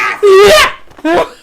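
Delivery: loud high shrieking laugh; screaming laugh. Real, not acted. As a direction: howl with laughter. Laughing hard at the clip.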